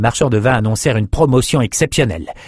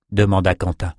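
plain reading voice

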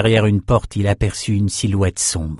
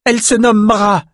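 high, loud cry; defiant shout; 12 semitones higher, 5 dB louder than usual. A narrator's reading. Young swordsman protesting for the panther, shouting. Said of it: "cria le jeune escrimeur".

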